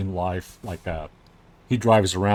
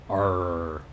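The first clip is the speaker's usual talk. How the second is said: pirate growl. Retold or imitated. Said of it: "default language on Facebook to pirate".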